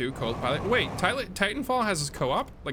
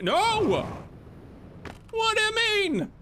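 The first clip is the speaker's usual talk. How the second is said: shocked protest at the screen; dismayed outcry; slower than usual. Real, not acted. Bridge missing in game.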